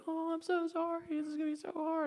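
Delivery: very high, soft falsetto coddling; hammed-up motherly pity in falsetto; mock fussing mom. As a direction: coo it in an exaggerated, squeaky sympathetic voice. Mocks wife's fussing over crying son.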